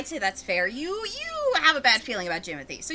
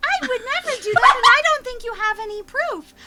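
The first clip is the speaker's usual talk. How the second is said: high, loud, quick protest; overacted innocence; indignant child; 11 semitones higher, 7 dB louder than usual.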